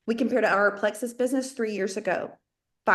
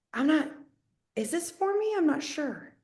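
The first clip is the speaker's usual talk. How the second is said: softer, hesitant self-doubt; unsure; 5 semitones higher, 6 dB quieter than usual. Retold or imitated. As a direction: trail off doubting yourself. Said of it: "you're still thinking".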